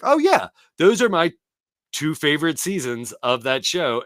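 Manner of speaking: high, loud delighted gush; suddenly thrilled; excited realization. Retold or imitated. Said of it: "I'm just like".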